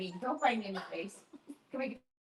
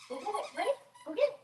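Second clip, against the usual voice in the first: much higher, eager play voice; playful fetch call; 13 semitones higher, 7 dB quieter, much faster than usual. Real, not acted. Owner urging the dog to fetch his toy, high and eager.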